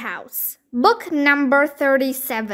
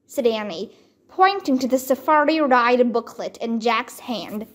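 ordinary delivery, nothing marked

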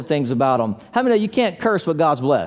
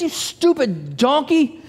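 mock angry; 7 semitones higher, slower than usual. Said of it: "Balaam gets off. He kicks".

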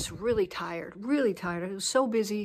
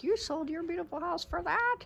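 higher, mock incredulous viewer; 8 semitones higher, 4 dB quieter than usual. Viewers reacting to the redo house, mock disbelief. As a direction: play a shocked follower, lightly. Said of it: "you're like".

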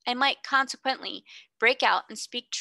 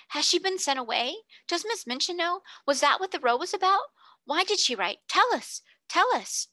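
higher, clamouring schoolgirls; excited babel; 8 semitones higher than usual. A narrator's reading. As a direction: pester her all at once. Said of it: "There was a perfect babel".

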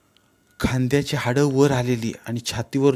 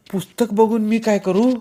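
higher-pitched brush-off question; dismissive rebuff; 8 semitones higher than usual. A narrator's reading. Girl rebuffs the boy's book, dismissive. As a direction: turn the offer down, voice lifted. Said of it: "तिनं पुन्हा मला झिडकारलं".

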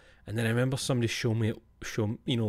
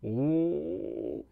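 mock shocked gasp; 6 semitones higher than usual. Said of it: "entire family were just like".

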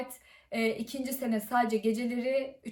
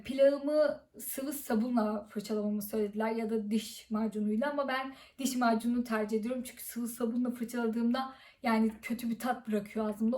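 ordinary delivery

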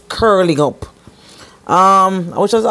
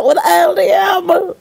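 calling out; 11 semitones higher than usual. Real, not acted. Calling to someone off camera.

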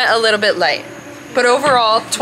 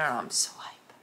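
whisper; 8 semitones lower, 12 dB quieter than usual. Real, not acted. Whispered aside about sushi.

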